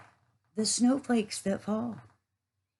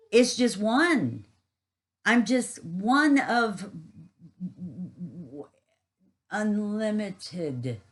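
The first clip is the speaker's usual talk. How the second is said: slow, awed; 2 semitones higher, 3 dB louder, much slower than usual. Real, not acted. Awed realisation of smallness.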